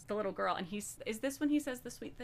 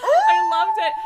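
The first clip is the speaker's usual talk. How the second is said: piercingly high, loud delighted shriek; ecstatic squeal; 18 dB louder, slower than usual. Real, not acted. Fan telling friends she loved the gift scene, ecstatic squeal. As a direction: shriek it through laughter.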